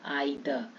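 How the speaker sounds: आइदर low and soft with an "eye" start; correct pronunciation repeated. Shown as a demonstration. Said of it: "क्या पढ़ेंगे?".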